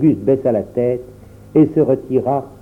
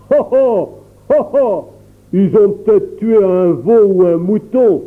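rire moqueur; 7 semitones higher, 4 dB louder than usual. A narrator's reading. Le père de Pierre se moque.

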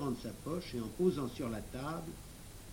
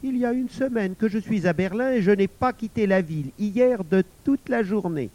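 smooth, higher-voiced denial through a smile; raised, ingratiating tone; obsequious; 7 semitones higher, 9 dB louder than usual. A narrator's reading. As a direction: deny it with oily politeness. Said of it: "avec un sourire obséquieux".

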